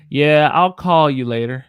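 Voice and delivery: "call" stretched long and loud; Southern held "call". Shown as a demonstration. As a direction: hold the a in "call" a bit longer. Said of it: "you hold it a little bit longer".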